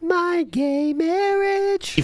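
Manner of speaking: high, slow drawn-out mocking whine. Streamer mocks the clip in a high, slow whine. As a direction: stretch it high and slow to mock.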